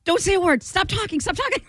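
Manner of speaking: high, pleading command to stop talking; a man at the urinal begging for silence; urgent hushing plea; stern man. Retold or imitated. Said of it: "And he goes".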